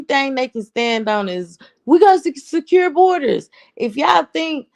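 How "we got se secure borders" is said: high, mocking parody of a proud claim; mock boast. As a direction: sneer at the slogan in a much higher voice. Mocking the Trump camp's claim.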